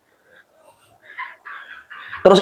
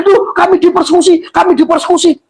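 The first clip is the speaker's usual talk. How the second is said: high-pitched mock victim wail; mock yelled complaint; 7 semitones higher than usual. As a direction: imitate them crying persecution, pitched high. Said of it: "sekarang teriak-teriak". (teriakan mengeluh pura-pura, nada tinggi)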